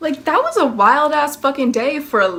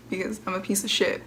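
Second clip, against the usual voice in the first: ordinary delivery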